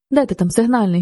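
plain reading voice

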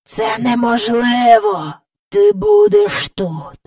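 slow, high croaky pronouncement; stretched, taunting elder's voice; gloating great-grandfather. Acted. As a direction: draw it out as a forbidding old man. Great-grandfather forbids Jane to leave.

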